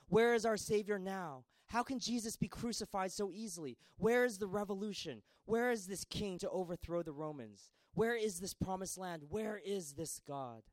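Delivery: high, loud anxious questions; fearful crowd. Acted. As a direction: ask like a frightened people. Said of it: "the people began to fear".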